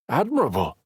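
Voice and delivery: mocking echo of admirable, falling; teasing. A narrator's reading. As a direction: tease by echoing Admirable back. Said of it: "Knox teased".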